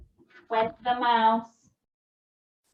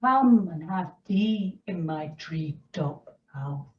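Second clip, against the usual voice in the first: low, soft owl inviting the mouse; a hushed, sly owl; 7 semitones lower, 4 dB quieter than usual. Acted.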